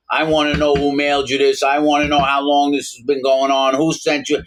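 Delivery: impatient boss demanding answers; irritated warden. Retold or imitated. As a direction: press him like an annoyed warden. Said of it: "He's getting pissed".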